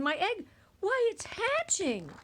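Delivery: high, loud thrilled cry; excited shout. A narrator's reading. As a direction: burst out with joy, rising high. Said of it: "shouted Horton".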